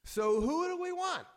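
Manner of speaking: high, loud rallying call; rallying a crowd. Acted. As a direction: call out to the crowd like a convention speaker. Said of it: "when Garfield said".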